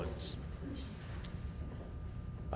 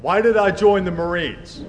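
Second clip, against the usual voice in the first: loud, raised drill-sergeant call; Marine voice; 4 semitones higher, 6 dB louder than usual. Real, not acted. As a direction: project it out like a Marine. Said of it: "utilize that Marine voice".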